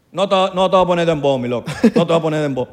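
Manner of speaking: put-on light Dominican accent, teasing. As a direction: do a Dominican guy defending his dembow. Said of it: "O te lanzan el de".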